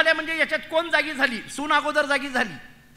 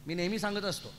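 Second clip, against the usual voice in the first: normal talking voice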